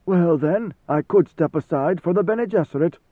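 mocking god-emperor. A narrator's reading. Ruler needles guardsman with rival powers, mocking.

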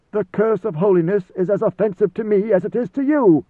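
high, loud ruler, stung; vehement god-emperor. A narrator's reading. Ruler protests his holiness to guardsman, vehement. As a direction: push it out high and forceful.

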